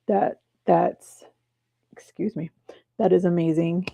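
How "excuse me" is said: whispered aside. Real, not acted. Aside after stumbling.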